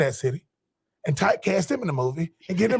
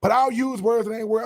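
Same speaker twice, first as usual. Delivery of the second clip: ordinary talk, nothing marked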